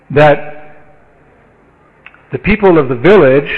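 ordinary talk

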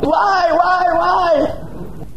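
high, frightened begging; terrified pleading. Acted. Dacoit leader begging the goddess to spare him, terrified. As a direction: plead for your life in terror.

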